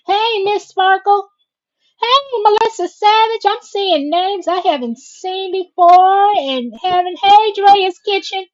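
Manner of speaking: light up as you greet each viewer by name; bubbly shout-outs to viewers in chat; excited greeting. Real, not acted.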